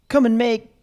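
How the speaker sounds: high, loud American hype-man voice; American accent. Retold or imitated. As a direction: sell it like an American online guru. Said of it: "you do an American accent".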